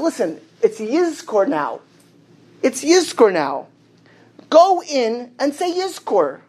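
slow, insistent retold appeal; drawn-out, emphatic urging.

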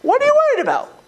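emphatic repeat. Real, not acted. Rabbi pressing students on their fear, high and emphatic. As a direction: push the question home, high and pointed.

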